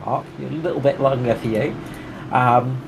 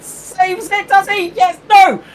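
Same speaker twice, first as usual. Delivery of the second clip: much higher, loud crash call; excited shout; 6 dB louder than usual. Real, not acted. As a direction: yell the crash as it happens. Crash called live.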